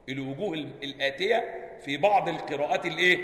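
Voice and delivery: ordinary talk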